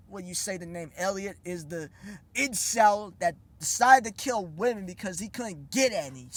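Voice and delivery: usual speaking voice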